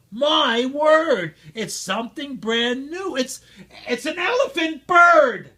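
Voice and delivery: high, loud wonder building to a shout; amazed shout. A narrator's reading. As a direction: crowd marvels at the new creature. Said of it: "they shouted".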